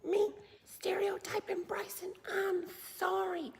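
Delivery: whispered, groveling apology rising high; mock whiny apology. Mocking someone apologizing to a hostile country, whispered. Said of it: "and go".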